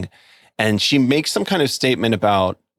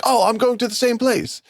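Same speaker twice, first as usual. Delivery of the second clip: high, eager imitated old officer; older military guy; 14 semitones higher than usual. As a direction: play the officer delightedly joining in, pitched high. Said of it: "this older military guy comes in".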